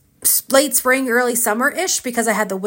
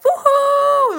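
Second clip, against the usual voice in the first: high, bright whoop of triumph; joyful cheer. Retold or imitated. Skydiver whooping after landing with the instructor, excited whoop. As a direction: cheer like you just landed a skydive.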